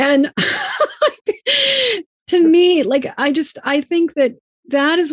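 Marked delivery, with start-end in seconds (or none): 0.4-2.0 real burst of laughter, far higher; high shrieking laugh. Real, not acted. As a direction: let a shrill laugh break out. Laughing at the retold toddler scene.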